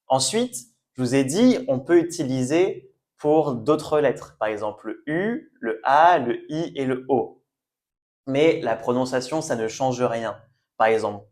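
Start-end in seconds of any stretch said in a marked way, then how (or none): none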